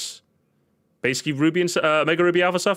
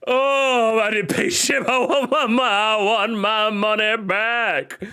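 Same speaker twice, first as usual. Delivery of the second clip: laughing; 8 semitones higher, slower than usual. Real, not acted. Mock outrage laughing.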